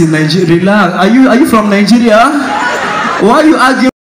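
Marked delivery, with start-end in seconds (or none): none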